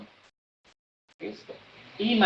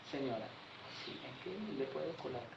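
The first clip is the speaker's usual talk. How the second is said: much softer, lower, barely audible; too-soft reply; 4 semitones lower, 22 dB quieter than usual. Shown as a demonstration. An overly respectful agent answering the deaf lady far too softly. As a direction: murmur it politely and too low. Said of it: "se cree súper respetuoso".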